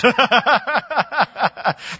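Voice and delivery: high amused chuckle; laugh. Real, not acted. Preacher laughing at his own irreverent paraphrase, amused chuckle. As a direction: break into a laugh at the joke.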